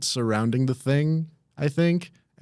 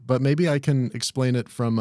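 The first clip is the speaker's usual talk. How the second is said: usual speaking voice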